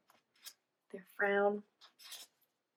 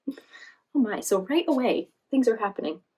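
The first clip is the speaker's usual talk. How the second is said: ordinary delivery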